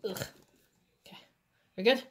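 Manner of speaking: ordinary delivery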